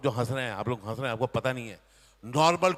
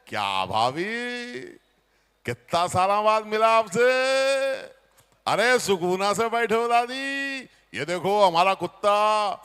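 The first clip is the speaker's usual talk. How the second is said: slow, drawn-out Hyderabadi Hindi, louder; Hyderabadi accent; 3 semitones higher, 6 dB louder, slower than usual. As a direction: greet the sister-in-law in a lazy Hyderabadi lilt. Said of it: "कैसे बात करता है हैदराबाद की भाषा में".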